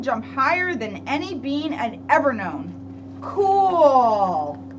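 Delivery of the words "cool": usual speaking voice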